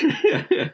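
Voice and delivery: sudden high laugh; high burst of laughter. Real, not acted.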